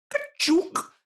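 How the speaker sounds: squeaky high "ka-chook" sound effect; high transforming whoosh. Shown as a demonstration. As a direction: zap the ball into a new shape. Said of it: "make a sound like".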